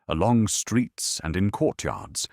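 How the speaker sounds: usual speaking voice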